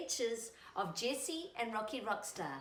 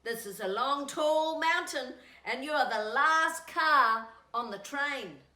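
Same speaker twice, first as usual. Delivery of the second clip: a touch louder, steady call; flat car calling; 4 semitones higher, 7 dB louder than usual. Acted. Flat car warning the caboose about the mountain, mildly loud. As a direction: warn the caboose over the noise.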